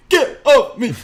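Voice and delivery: high, loud mock shriek breaking into laughter; imitated scream. Host re-enacting commentator's panic, screaming through laughter. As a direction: yell it like a panicked commentator, then crack up. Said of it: "he was screaming".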